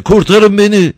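very high, loud scream of "Kurtarın beni!"; terrified cry for help. Acted. Fleeing man screaming to the priest to save him, high and terrified. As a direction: scream for rescue. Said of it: "yüzü korkudan allak bullak".

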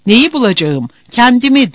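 angry outburst. A narrator's reading. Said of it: "İyiden iyiye öfkelenmişti".

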